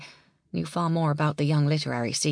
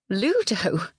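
scoffing; 4 semitones higher than usual. Acted. Janey scoffs at the name.

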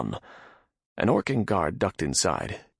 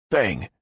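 sharp bang; banging noise; 3 semitones higher, 5 dB louder than usual. A narrator's reading. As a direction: punch out a bang sound. Banging sound imitated.

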